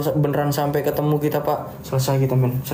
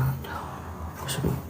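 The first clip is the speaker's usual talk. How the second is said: whisper; 11 dB quieter, much slower than usual. Real, not acted. Someone grabbing his hand in dark.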